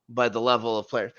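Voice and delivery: normal talking voice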